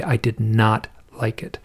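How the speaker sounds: whispered, retelling the friend's unease; hushed. Retold or imitated. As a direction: breathe it out like a confession. Friend recounting eerie dead mother.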